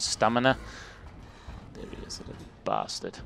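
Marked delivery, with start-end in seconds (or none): 1.7-3.2 hushed whisper. Real, not acted. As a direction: whisper it so he can't hear. Sneaking up, whispering to self.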